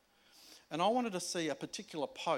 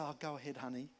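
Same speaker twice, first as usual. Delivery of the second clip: soft, low mock-sweet coaxing; mock indulgent parent; 4 semitones lower, 5 dB quieter than usual. Parent waving a child toward fire, mock indulgent. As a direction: lightly play a permissive parent, lower and quieter.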